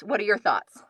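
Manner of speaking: ordinary delivery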